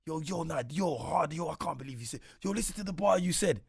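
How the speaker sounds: loud, high hyped-up friend; excited hype. Retold or imitated. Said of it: "But Sticks will be like".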